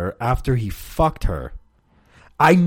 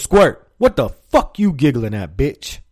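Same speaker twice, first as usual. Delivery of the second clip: lightly voiced angry coach, louder; mock coach scolding; 3 semitones higher, 6 dB louder than usual. Coach confronting a giggling player, mock scolding.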